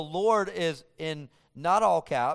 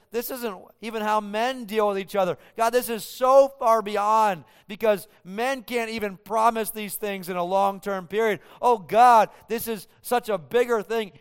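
rising louder as wonder grows, peaking on oh God; awed outcry; 6 semitones higher, 8 dB louder than usual. Acted. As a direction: cry out to God in amazement. Preacher voices David's awed reply.

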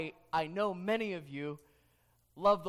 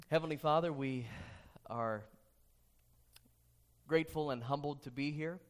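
hushed, lower prayer voice; 6 semitones lower than usual. Real, not acted. Leads the congregation in prayer.

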